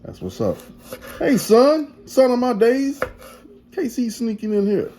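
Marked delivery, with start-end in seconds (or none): 1.2-4.9 high, loud happy hello to his son; excited greeting. Real, not acted.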